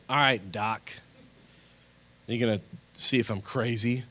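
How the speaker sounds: cocky retold teenage attitude; bad attitude. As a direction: play the arrogant patient lightly. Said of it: "with this really bad attitude".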